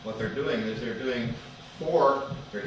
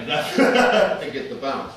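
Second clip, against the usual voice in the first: laughing; 7 dB louder than usual. Real, not acted. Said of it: "You're laughing".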